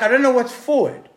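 high, loud protest of confusion; frustrated, pitch leaping up; exasperated retort. Retold or imitated. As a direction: throw it back high and loud, at a loss. Retold frustrated reply when lost.

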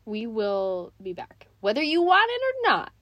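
mock threat. Playful threatening sign-off.

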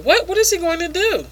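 high-pitched puzzled question; imitating a baffled onlooker; mock bewilderment. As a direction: ask it high, in put-on disbelief.

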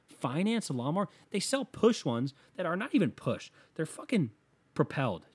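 usual speaking voice

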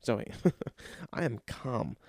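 soft, slow, low reassurance; feigned calm. Retold or imitated. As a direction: keep it down and play innocent. Insists he is calm to girlfriend.

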